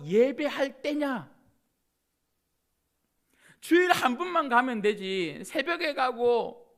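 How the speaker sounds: a worldly critic's sneering lecture, ending in a laugh; mocking skeptic. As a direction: scold a churchgoer with contempt. Voicing a scornful unbeliever.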